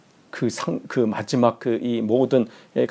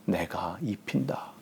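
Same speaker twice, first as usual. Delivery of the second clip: hushed divine promise; 4 semitones lower, 13 dB quieter, slower than usual. Acted. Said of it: "주시는 말씀입니다".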